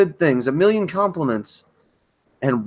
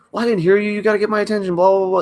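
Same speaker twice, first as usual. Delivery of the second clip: higher, acted-out angry retort; retold going off; 6 semitones higher, much faster than usual. Retelling snapping at partner who asked for help, retold angry rant. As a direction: go off on her in imitation, trailing into blah-blahs. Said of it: "I just started going off on her".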